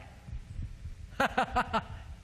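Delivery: mimicked breathy, voiceless laughing fit; imitated laughter. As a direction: imitate a colleague cracking up. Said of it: "Jeff's like".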